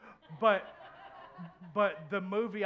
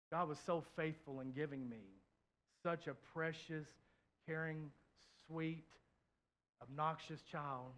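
tender, soft; 3 semitones lower, 8 dB quieter than usual. Real, not acted. Tender description of daughter.

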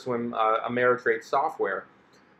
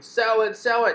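pitched-up mock chant of sell it; online crowd urging; 9 semitones higher than usual. Online readers urging the investor to sell, higher mock voice. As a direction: imitate the pushy forum voices. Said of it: "people are going".

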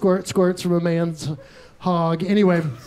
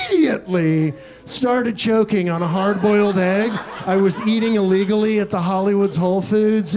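usual speaking voice

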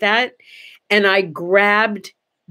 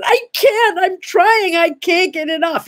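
high-pitched frantic protest, rushed and desperate; panicked pleading; 10 semitones higher than usual. Retold or imitated. Pleading with the robber in story.

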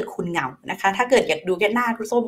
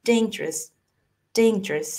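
"dangerous" run together as a short "dayn-jrus"; brief two-syllable "dangerous"; squeezed short; much slower than usual. Shown as a demonstration. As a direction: squeeze "dangerous" tight with no break. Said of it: "บีบเข้าไปอีกให้มันสั้น".

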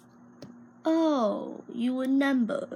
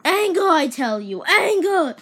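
anger bellowed loud and higher; hammed-up angry shout; 6 semitones higher, 8 dB louder than usual. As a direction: roar the word anger over and over. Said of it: "yeah I get it".

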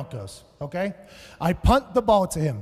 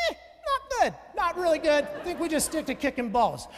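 much higher, casual mock mother voice; imitating his mom; 9 semitones higher, 3 dB quieter than usual. Comic imitating his mother's backstage verdict, high and casual. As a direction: dismiss him like a mother unimpressed. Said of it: "she'll come backstage very casually and go".

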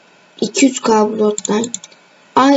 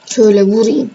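ordinary talk

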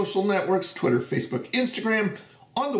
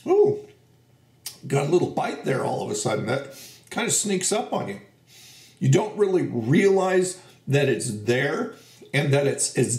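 talking between chews; words slightly muffled by food; mouth full, chewing; 2 semitones lower than usual. Real, not acted. Seen 0: Holds bitten rind, jaw working between words, recently bit in.

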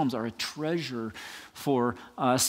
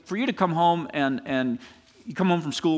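ordinary delivery

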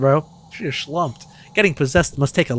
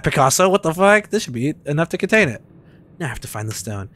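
ordinary talk

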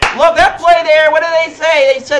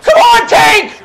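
loud, high shout at a player; urgent, raised-voice outburst; frustrated yell; 9 semitones higher, 6 dB louder, slower than usual. Real, not acted. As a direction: yell in exasperation at the game. Yelling at his player.

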